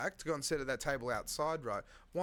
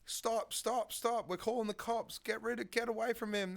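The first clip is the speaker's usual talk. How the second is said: mock-urgent higher-pitched cries to stop; higher, urgent retelling of onlookers' shouts; retold screaming out; 7 semitones higher than usual. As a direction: imitate bystanders calling out, without a full yell. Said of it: "screaming out, being like".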